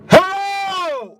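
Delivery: very high, loud shout into the tube; screamed hello. Retold or imitated. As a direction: yell it as loud as a kid can. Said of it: "I get the tube and I go".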